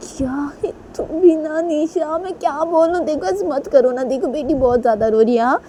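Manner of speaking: high, fretful plea; exasperated pleading. Acted. Manisha pleads with Nisha as baby cries, exasperated.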